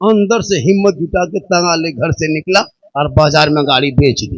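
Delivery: ordinary delivery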